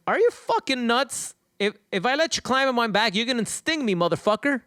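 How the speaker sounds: high-pitched, indignant disbelief; incredulous frog. Acted. Frog answering the scorpion's request to ride along, incredulous.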